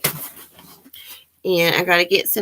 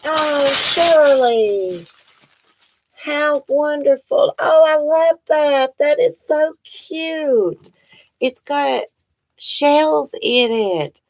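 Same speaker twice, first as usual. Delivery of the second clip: higher, slow and drawn out with delight; delighted gushing; 8 semitones higher, slower than usual. Real, not acted. Opening a handmade necklace from Shirley, high, slow, delighted gushing. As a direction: gush over the gift.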